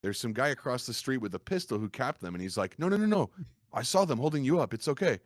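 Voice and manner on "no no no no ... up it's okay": high, loud imitation of a bystander; acted urgent "it's okay" shout; urgent reassurance. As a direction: call out higher and louder to calm someone. Voices bystander in retold game scene.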